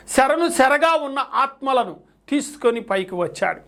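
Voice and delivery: ordinary talk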